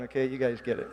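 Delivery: normal talking voice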